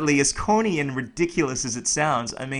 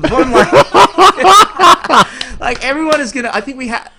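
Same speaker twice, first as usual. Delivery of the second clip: laughing; 10 semitones higher, 14 dB louder than usual. Real, not acted. Still laughing, louder.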